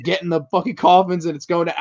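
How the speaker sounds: usual speaking voice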